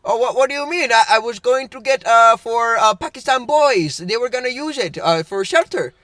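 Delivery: hammed-up Indian-accented customer; imitated Indian accent. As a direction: put on an Indian man's accent, insistent. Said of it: "I can do the accent".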